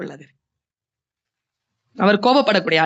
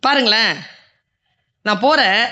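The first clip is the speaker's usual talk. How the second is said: normal talking voice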